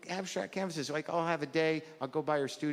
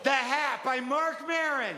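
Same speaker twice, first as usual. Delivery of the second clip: slow, grand announcement of a title; mock book title; 10 semitones higher, slower than usual. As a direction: present it like a proud book title. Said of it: "I think I just wrote a children's book".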